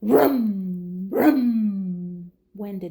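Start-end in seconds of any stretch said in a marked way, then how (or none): none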